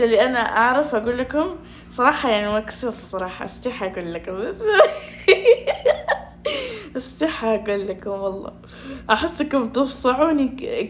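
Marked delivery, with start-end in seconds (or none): 4.6-6.2 high, squealing embarrassed laugh; embarrassed giggle. Real, not acted. (ضحكة خجولة عالية)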